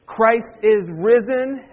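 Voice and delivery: slow, measured congregational line; liturgical proclamation. Shown as a demonstration. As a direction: proclaim it slowly, word by word. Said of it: "I'm gonna be the leader".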